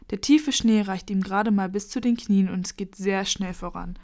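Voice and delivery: ordinary delivery, nothing marked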